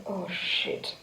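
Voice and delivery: a hint of a stunned mutter; muttered oh shit. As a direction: drop the voice in dismay. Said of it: "I just said".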